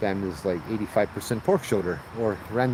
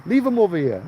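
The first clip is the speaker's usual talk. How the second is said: high, louder bossy auntie; auntie calling out; 11 semitones higher, 3 dB louder than usual. Retold or imitated. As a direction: bark it from the back seat, high. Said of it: "you hear one auntie in the back".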